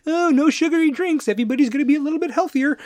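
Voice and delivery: very high, prissy mock voice; mocking high-pitched killjoy; Bloomberg. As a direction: fuss in a squeaky nanny voice. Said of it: "Bloomberg with the fucking".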